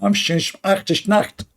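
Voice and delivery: high mock German accent. Shown as a demonstration. A bad German accent, showing off to the audience. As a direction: bark the German line. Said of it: "my German accent is very good".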